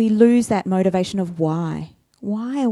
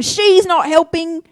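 higher, louder pointed complaint; louder tattling demand; accusing Martha; 8 semitones higher, 7 dB louder than usual. Acted. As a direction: jab a finger at her sister.

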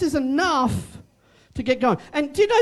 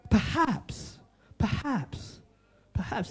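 each perhaps softer than the last; fading away; 2 semitones lower, 8 dB quieter, slower than usual. Acted. Preacher lets the word perhaps fade, softening each time. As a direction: let perhaps die away to nothing.

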